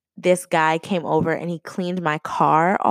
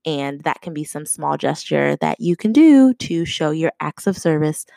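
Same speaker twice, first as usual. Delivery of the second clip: ordinary talk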